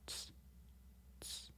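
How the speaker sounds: "ts" said as a short hissing t-s like the end of cats; ts sound. Shown as a demonstration. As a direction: clip "ts" sharp and airy. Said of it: "You pronounce ts like the end of cats".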